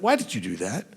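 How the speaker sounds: low, soft, displeased question; quietly pointed, pitched low. Shown as a demonstration. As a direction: keep it down, with a little edge. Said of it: "my tone of voice is this loud".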